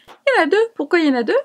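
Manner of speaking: high, loud burst of surprise; surprised discovery. Real, not acted. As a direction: exclaim it high, then question it in puzzlement. Découvre un second côté, étonnée. (surprise aiguë et sonore)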